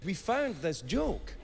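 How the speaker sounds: normal talking voice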